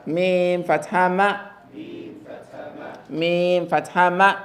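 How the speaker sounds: slow, drawn-out model recitation; teacher drilling slowly; slow spelling drill. Shown as a demonstration. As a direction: spell it slowly for the class to repeat. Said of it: "you spell it as meme fatha ma".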